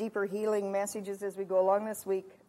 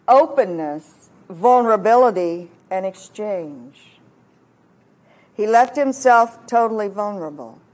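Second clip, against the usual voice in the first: forceful preaching; 11 dB louder than usual. Acted. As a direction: preach it loudly, giving each key word room. Preaches on the cross.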